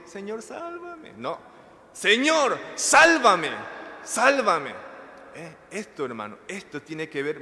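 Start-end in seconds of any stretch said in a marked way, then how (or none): 0.0-1.1 tiny high-pitched soft plea, drawn out; feeble, slow squeak of a prayer; meek, not shouted. Shown as a demonstration. Preacher mimicking how Peter did not cry out, meek high plea. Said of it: "Gritó, no dijo". 1.9-4.9 loud, high shouted plea for rescue; crying out. Shown as a demonstration. Preacher showing how Peter cried to the Lord, shouted plea. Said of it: "Gritó, no dijo".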